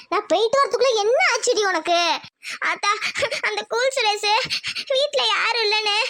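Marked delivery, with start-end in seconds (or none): 2.7-6.0 halting words broken by sobs; crying. Acted. Daughter tells mother about the fiancé, crying. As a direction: sob between each phrase. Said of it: "சரி அழாதடி".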